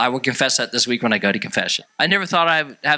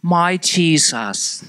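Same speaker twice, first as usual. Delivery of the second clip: slow, higher-pitched imitation British accent; 4 semitones higher, much slower than usual. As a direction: draw it out like a British woman praying. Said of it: "that woman with that British accent".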